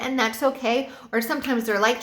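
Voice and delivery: ordinary talk, nothing marked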